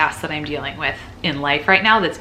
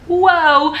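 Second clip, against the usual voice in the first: a high, loud startled whoa; surprised whoa; 12 semitones higher, 7 dB louder than usual. Real, not acted. Telling viewers about side effects, a loud high whoa. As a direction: let it jump out of you, wide-eyed.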